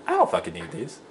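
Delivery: high-pitched mocking quote; mock game character. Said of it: "he's like".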